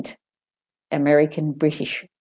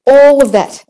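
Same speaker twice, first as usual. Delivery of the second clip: louder, stretched "all" in "all of that"; emphatic ALL; 8 semitones higher, 11 dB louder than usual. Real, not acted. Teacher tells learners to practise everything, heavy stress on "all". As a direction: lean hard and loud on "all".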